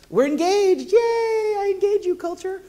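high, slow, squealing mock cheer. Pastor mocking a cheery take on engaging culture, squealed high. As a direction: gush it like a giddy fan, drawn out. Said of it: "Is it like".

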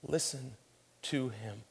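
hushed, solemn divine command; softly spoken, with weighty pauses between words; voice from heaven. Acted. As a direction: speak it quietly as a heavenly voice. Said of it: "a voice from heaven calls out".

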